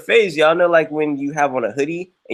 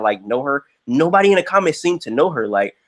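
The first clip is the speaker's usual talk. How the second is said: normal talking voice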